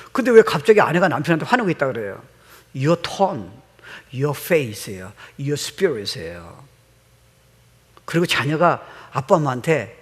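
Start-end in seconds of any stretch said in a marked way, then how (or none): 2.8-6.6 drawn-out emphasis. Real, not acted. Preacher stresses cause of anger.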